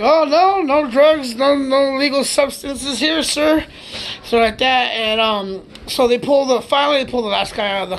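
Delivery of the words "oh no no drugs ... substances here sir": high, louder eager denial; innocent denial. Retold or imitated. Friends assuring the cop there are no drugs, high innocent voice. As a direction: sound overly innocent and eager. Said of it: "we're like".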